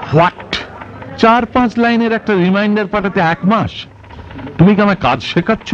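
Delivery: high-pitched, incredulous outrage; indignant outburst. Acted. Pensioner to clerk over a month's delay, indignant.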